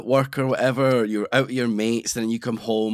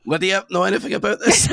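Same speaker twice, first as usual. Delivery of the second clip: voice cracking; 7 semitones higher than usual. Real, not acted. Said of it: "why did your voice break then".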